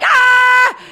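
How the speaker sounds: put-on loud high toddler yell; mock kid's yell, loud and high. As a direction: shriek like a two-year-old. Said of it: "Like, yelling".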